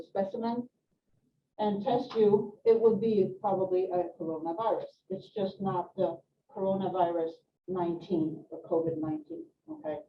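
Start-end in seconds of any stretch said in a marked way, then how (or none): none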